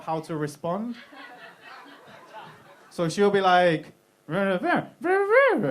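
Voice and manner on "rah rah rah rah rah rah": high-pitched mock nagging babble; girlfriend's wordless inflection. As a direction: mimic a girlfriend's tone with nonsense syllables. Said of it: "the inflection in a voice".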